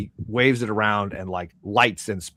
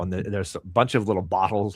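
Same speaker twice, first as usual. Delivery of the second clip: usual speaking voice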